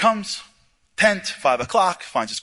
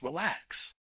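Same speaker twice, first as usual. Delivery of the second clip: soft calming; 11 dB quieter than usual. Retold or imitated. Commander calms him.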